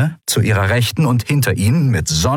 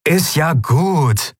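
slow, higher, drawn-out concession; placating; 8 semitones higher, much slower than usual. A narrator's reading. Leader yielding to the commander, placating. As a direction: give in with drawn-out words.

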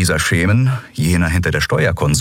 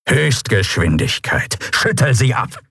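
commanding cat captain order; 4 semitones higher than usual. Acted. Chasu orders his pilot to shake off pursuers, commanding. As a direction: command that climbs on schüttel.

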